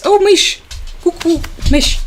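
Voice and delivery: high sing-song call to a pet; calling the dog. Real, not acted.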